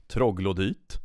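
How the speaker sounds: "Troglodyte" with Swedish vowels, "troh-gloh-deet"; Swedish pronunciation. Shown as a demonstration. Streamer repeating the Swedish word "Troglodyte", ending "-deet". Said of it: "How do you say troglodyte in Swedish".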